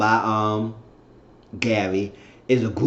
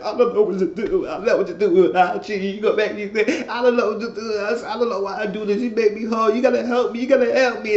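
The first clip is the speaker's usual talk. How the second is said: mock crying; 9 semitones higher than usual. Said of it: "He crying".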